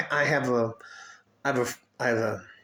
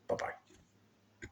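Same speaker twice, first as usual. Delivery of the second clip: soft whispered goodbye; 14 dB quieter than usual. Real, not acted. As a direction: breathe the farewell. Whispered sign-off.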